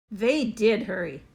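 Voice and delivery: ordinary delivery, nothing marked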